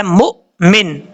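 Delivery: مؤمن split by a glottal catch after mu; with a jerk. Shown as a demonstration. Teacher introducing the word to students, jerked مؤمن. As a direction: stop short on the mu of مؤمن, then say min. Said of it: "جھٹکے کے ساتھ پڑھا جائے گا".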